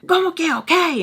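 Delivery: high, incredulous come-again; Spanish-English flip of disbelief; indignant retort. Retold or imitated. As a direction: throw it back at him, outraged. Retold retort to father.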